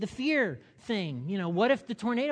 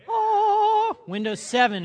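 mock surprise; 10 dB louder, slower than usual. Mock gasp at Windows seven.

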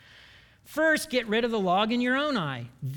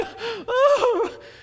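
a big, high, loud mock gasp; high dramatic gasp; 7 dB louder than usual. Late coworker ends excuses with a dramatic gasp. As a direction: gasp at your own bad luck.

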